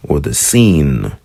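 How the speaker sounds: "seen" said slowly with a crisp S and a falling tune; Hebrew seen. Shown as a demonstration. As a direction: draw out "seen" with a clean S. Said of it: "I wanted to have a distinction between".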